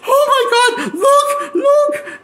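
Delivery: imitating giddy fans gawking at gore; mock excited squeal.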